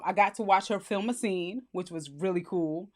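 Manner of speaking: usual speaking voice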